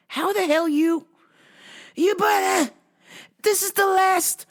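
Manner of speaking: high, strained mock outrage; so angry. Man so mad at the last pump, high mock outrage. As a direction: wail at the pump in a high-pitched retold fit. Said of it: "so angry".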